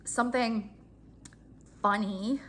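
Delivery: ordinary talk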